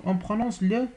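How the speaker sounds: ordinary delivery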